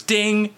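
high, short chime noise; imitated ding. Lie detector clears the wrestler, high imitated ding. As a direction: mimic the machine's bell. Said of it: "And it goes".

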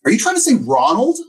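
high-pitched mock incredulity; disbelieving, high questioning voice; baffled question. Acting out his baffled question.

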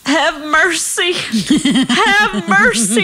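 high, drawn-out plea of exaggerated agony; mock pained wail. Uncomfortable patient heard down the whole hallway, high hammed-up wail. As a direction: wail slowly like a melodramatic patient. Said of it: "how he's expressing his pain".

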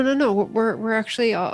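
ordinary talk, nothing marked

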